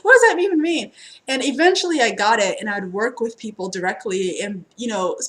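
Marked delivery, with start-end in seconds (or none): 0.0-0.9 higher and louder, laughing through it; laughing disbelief. Retold or imitated. Reader laughs at being called a goddess, incredulous.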